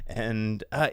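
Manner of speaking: ordinary delivery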